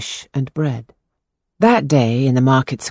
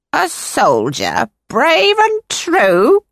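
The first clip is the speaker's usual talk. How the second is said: slow, mocking high rising question; fortune teller; 12 semitones higher, 4 dB louder, much slower than usual. A narrator's reading. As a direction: scoff at the boy's boast, slowly. Fortune-teller mocks the boy.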